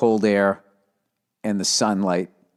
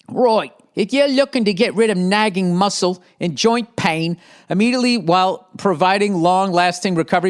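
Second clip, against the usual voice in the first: hammed-up English accent, pitched high; mock English accent, raised voice; bad English accent; 9 semitones higher, 3 dB louder than usual. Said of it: "I'm doing a bad English accent".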